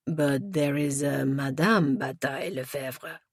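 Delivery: put-on slow, puzzled French accent; French-accented, deliberate objection; French inspector. A narrator's reading. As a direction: point it out slowly with a French accent.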